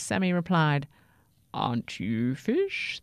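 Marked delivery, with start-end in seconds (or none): 1.5-3.0 low, slow bird voice; puzzled albatross. Acted. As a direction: ask slowly in a low bird voice. Albatross questions the toothbrush.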